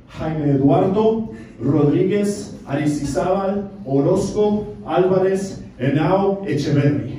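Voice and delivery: long name spelled out slowly, part by part; unhurried Spanish roll-call of every surname; slow name recital. Shown as a demonstration. Comic tells the crowd what Lalo is short for, slow recital. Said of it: "So Lalo is short for".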